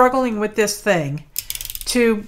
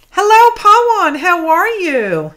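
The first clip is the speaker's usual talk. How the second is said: cheery greeting; 9 semitones higher, 8 dB louder than usual. Real, not acted. Host greets a returning viewer loudly and brightly. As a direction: sing out a warm welcome.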